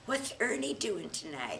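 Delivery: put-on Southern accent. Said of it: "in that Southern accent".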